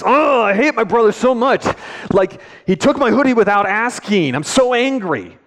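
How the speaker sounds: put-on high-pitched angry child complaining; angry kid ranting. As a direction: play the son venting his fury at mom.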